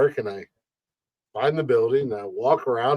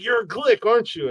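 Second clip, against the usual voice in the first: higher-pitched excited question; eager fan; 9 semitones higher than usual. Retold or imitated. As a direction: imitate a fan seeking confirmation. Fan checks it is the host.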